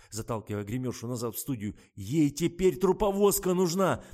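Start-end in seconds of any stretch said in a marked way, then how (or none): 1.9-4.0 high, loud, slow exclamation; furious panic. A narrator's reading. Adman stops Kira calling a doctor, furious. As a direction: berate her in alarm. Adman rages at makeup girl.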